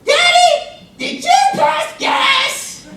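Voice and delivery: put-on high, loud little-girl voice, dragged out slowly; loud, high child blurting out an embarrassing question; little daughter shouting. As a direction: shout it across the store like a delighted toddler. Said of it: "and she says".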